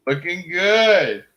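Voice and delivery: teasing compliment. Real, not acted. Slow drawn compliment.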